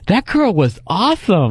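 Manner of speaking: mock effeminate swooping gush; hammed-up lilting delivery, pitch swinging up and down. Comic acts out friend ruining the girl-watching, mock effeminate gush.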